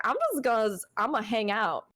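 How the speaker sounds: lightly put-on gamer accent; player voice. Player talking themselves into just hanging out, in a lightly put-on player voice. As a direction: slip lightly into a casual player voice. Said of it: "Where does this accent come from?".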